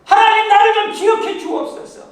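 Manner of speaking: high, loud cry to God; shouting a plea to heaven; loud prayer cry. Shown as a demonstration. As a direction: plead at full voice. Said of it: "한번 합시다".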